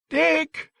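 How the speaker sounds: put-on very high child's cry; terrified. A narrator's reading. Terrified boy calls for Dick, sobbing in fear. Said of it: "The boy was sobbing now, in terror".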